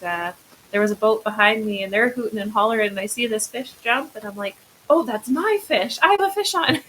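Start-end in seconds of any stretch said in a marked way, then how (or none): 4.9-6.8 retold excited cry, pitched high; excited realisation. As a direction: call it out as if the catch just dawned on you. Retelling sudden realisation of catch.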